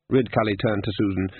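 plain reading voice

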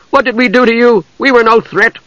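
high-pitched indignant cry; sudden outcry. A narrator's reading. Usha protesting to the invading knight, high indignant cry. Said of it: "she cried suddenly".

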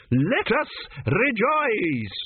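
slow, high, drawn-out oratory; ringing preacher's exhortation; grand proclamation. A narrator's reading. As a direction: proclaim it to the whole congregation. Orator proclaims to assembled priests.

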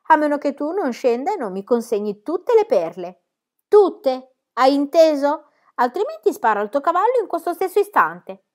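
menacing. A narrator's reading. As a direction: push the ultimatum hard. Thug threatens Pippi.

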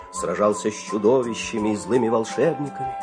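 ordinary delivery

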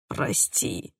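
a near-silent whispered apology. A narrator's reading. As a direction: breathe the word out, barely audible. Quiet apology after bad news. (шёпотом)

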